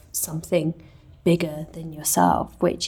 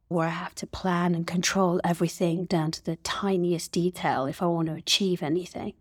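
ordinary talk

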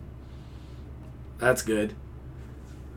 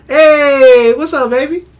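high, loud sing-song hello; playful greeting; 10 semitones higher, 7 dB louder, slower than usual. Real, not acted. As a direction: call out a cheery hello, pitched up. Greeting someone off mic.

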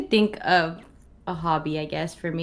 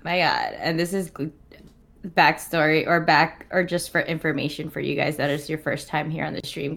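ordinary talk

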